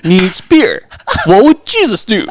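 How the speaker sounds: booming cartoon mascot voice; Duff Man voice. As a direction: play the beer mascot big. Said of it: "Duff Man voice, like, from The Simpsons".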